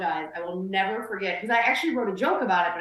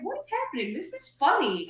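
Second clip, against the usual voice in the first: baffled exclamation; 5 semitones higher, 4 dB quieter than usual. Retold or imitated. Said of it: "And I was like".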